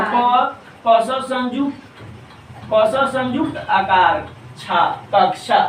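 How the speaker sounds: measured spelling-out of कक्षा for pupils; शुद्ध उच्चारण. Shown as a demonstration. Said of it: "शुद्ध प्रोनाउंसिएशन हो गया". (धीरे हिज्जे जोड़कर शुद्ध कक्षा)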